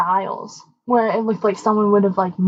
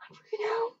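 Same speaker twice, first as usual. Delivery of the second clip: whisper; 13 semitones higher, 13 dB quieter than usual. Retold or imitated. Telling teacher about ghost.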